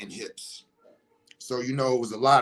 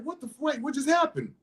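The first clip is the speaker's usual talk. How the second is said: taken aback, voice pitched up; baffled onlookers; 11 semitones higher than usual. Retold or imitated. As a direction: imitate a stunned group. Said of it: "they was all like".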